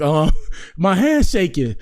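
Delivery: slow, laughing nervously. Real, not acted.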